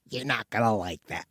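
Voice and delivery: ominous warning. Retold or imitated. Ed Warren warning the priest, low and ominous. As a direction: murmur it low like a grim prophecy.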